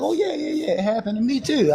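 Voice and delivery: high, mock-casual big brother; imitating the big brother's offhand shrug; big brother quote. Telling a friend how big brother brushed it off, high mock-casual voice. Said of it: "He was like".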